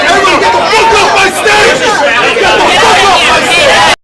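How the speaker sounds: furious shout. Real, not acted. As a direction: bellow them off the stage. Host ordering hecklers off stage.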